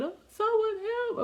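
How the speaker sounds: high-pitched, acted cry for help; mock tearful plea. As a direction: beg as if crying. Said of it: "we were just crying and crying".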